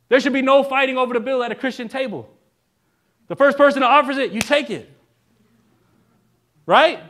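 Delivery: forceful preaching. Real, not acted. Preacher urging the church to accept paid meals, forceful preaching. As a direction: drive the point home to the congregation.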